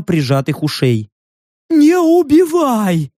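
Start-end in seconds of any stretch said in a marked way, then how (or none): 1.7-3.1 high, drawn-out frightened yelp; yelping beast. A narrator's reading. Beast-faced stranger begs for life, yelping. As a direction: yelp it like a cornered animal. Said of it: "тявкнул незнакомец".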